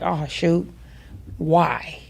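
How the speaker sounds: soft, low mutter of dismay. Retold or imitated. Wheel starts shaking on the drive, soft low dismay. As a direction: say it under your breath, dismayed.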